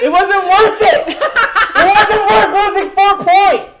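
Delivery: high, loud and slow, laughing through it; laughing in pain. Real, not acted. Burning mouth, laughing in pain.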